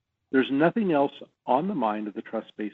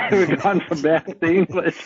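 loud, higher, through laughter; laughing; 6 semitones higher, 9 dB louder than usual. Real, not acted. Guest going along with the host's math-to-English joke, laughing loudly. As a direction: laugh while saying it.

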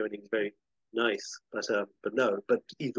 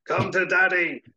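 loud, higher comic call; mock call; 9 semitones higher, 8 dB louder than usual. She would take anyone instead of Peter, loud mock call. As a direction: call it out with playful relish. Acted quip of choosing anyone else.

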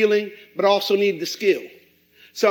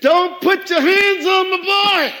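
protective warning; 7 semitones higher, slower than usual. Retold or imitated. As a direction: warn the coach off your son. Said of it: "I'm like".

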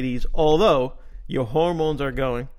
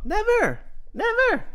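both never's high and falling hard; stressing that race never came up, pitched high; emphatic never. Real, not acted. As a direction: hit never twice, high. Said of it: "we never once even made a".

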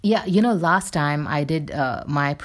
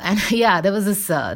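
normal talking voice

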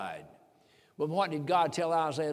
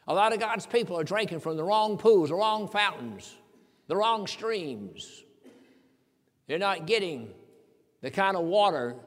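slowing down on each phrase; preacher's cadence, each phrase spaced out; preaching build. Acted.

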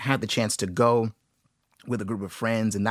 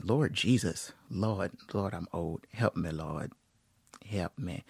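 lightly voiced Southern old folks lament, dropping low and soft; Southern old-timer accent; 4 semitones lower, 7 dB quieter, slower than usual. Host joking about getting old, mild Southern accent. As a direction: groan to the Lord in a mild Southern drawl. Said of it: "you all of a sudden do a Southern accent".

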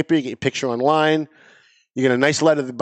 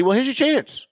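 higher, pointed dare to the complainers; taunting challenge; 10 semitones higher than usual. Real, not acted. Host daring the listeners who always complain, taunting. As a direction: throw it back at the whiners.

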